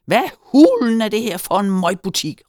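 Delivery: loud, high outburst of fury; enraged shout. A narrator's reading. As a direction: yell like you are red with rage. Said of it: "råbte han, rød i hovedet af raseri".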